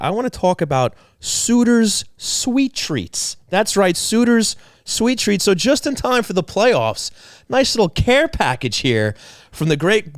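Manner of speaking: slow, high, showy ad read voice. Acted. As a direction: sell it like an announcer. Hosts a sponsor ad read.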